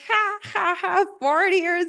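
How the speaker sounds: high, hammed-up "ha ha ha"; mock burst of laughter. Guy laughing at the dad's forty years, mock burst of laughter. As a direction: imitate someone laughing in disbelief. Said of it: "the guy bursts out laughing".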